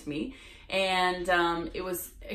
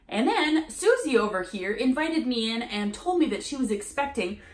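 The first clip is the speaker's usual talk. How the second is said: bright, higher, rehearsed salesy patter; scripted sales pitch; 4 semitones higher, 3 dB louder than usual. Retold or imitated. Vlogger imitating the chirpy saleswoman at her door. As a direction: rattle off a memorized door-to-door pitch. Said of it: "she had read and memorized the manuscript".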